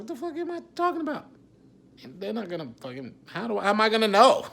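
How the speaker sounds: high, fast, laughing at his own idea; mock himself in a squeaky, rushed voice; incredulous self-mockery. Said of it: "I was like".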